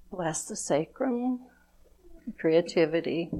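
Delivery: calm, measured blessing prayer; gentle ceremonial invocation; blessing ritual. Acted. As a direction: bless each chakra slowly, like a prayer. Leads a chakra blessing ritual.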